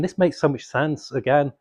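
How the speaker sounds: normal talking voice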